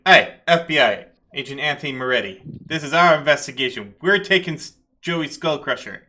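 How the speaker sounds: forceful federal agent pulling rank; assertive FBI agent. Acted. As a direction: take charge of the scene. FBI agent takes over the case.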